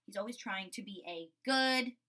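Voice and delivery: ordinary talk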